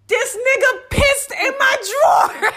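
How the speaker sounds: high, loud, slow laughing yell; laughing shout. Real, not acted. Teller hitting the punchline to her friend, loud laughing shout.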